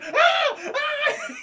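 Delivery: high-pitched, loud comic screams; mock scream. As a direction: yelp as if the blast heat hits you. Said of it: "He's screaming 'cause he feels the heat".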